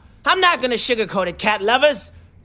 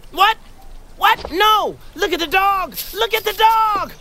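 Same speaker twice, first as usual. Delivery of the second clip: shocked outcry; 9 semitones higher than usual. Acted. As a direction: protest in shocked disbelief. Cat's plan backfires.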